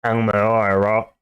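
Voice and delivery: a bad, half-hearted New Zealand accent on I'm Maori, bro. Shown as a demonstration. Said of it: "That was terrible accent 'cause I can't do accents".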